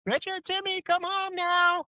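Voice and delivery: put-on sing-song mom calling the kids from the porch; mom yelling. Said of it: "she would yell".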